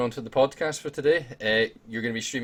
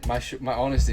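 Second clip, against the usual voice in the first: ordinary talk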